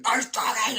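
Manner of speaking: Nice doggy squeaked very high and loud; panicked squeak. Retold or imitated. Panicked at the dog.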